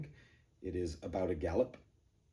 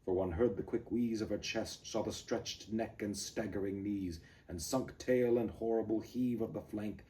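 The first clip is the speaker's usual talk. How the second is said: driving galloping poem reading; dramatic galloping recital; poem recitation; 3 semitones higher, 3 dB louder than usual. Acted.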